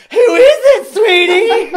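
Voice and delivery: high, loud falsetto lady calling out; shrill falsetto. Retold or imitated. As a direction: squeal it in a mock woman's voice. [name] voices a woman offstage.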